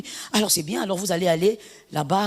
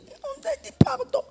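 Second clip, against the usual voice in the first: very high mock wail; hammed-up begging squeal to Daddy; wailing girl; 3 dB quieter than usual. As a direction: squeal it like a child in tears. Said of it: "Il dit".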